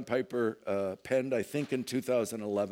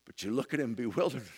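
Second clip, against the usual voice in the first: ordinary talk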